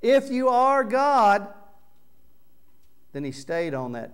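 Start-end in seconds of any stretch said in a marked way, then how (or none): 0.0-1.5 much higher, loud slow jeer; thief's mocking shout. Thief on the next cross shouting at Jesus, jeering. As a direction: scream the taunt at him. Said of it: "the thief mocked".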